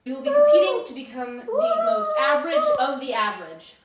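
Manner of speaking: high, loud wailing proclamation; mock dramatic announcement. Judge announcing the contest, over the top.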